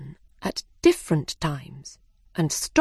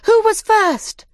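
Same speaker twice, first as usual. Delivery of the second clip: even higher, louder and slower; excited shout; 14 semitones higher, 7 dB louder, slower than usual. A narrator's reading. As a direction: cry it out even more eagerly. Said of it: "they shouted".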